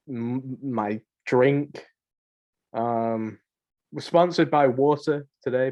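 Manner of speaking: usual speaking voice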